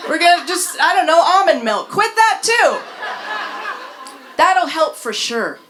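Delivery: hammed-up health nut; mock wellness friend. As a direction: pile on the diet advice, breathless and bright. Continuing mock health advice.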